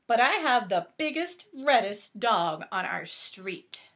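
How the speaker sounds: lightly animated storybook narration; reading to children. A narrator's reading. Reading Clifford aloud to a child, gentle storybook voice. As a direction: read it out gently to little ones. Reads picture book aloud.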